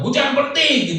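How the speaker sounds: high mock shout at the rain; yelling at the rain. Preacher mocks someone yelling at rain, loud shout. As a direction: bark the order at the rain like a madman. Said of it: "teriak-teriak kepada hujan".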